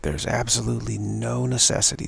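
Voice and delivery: ordinary delivery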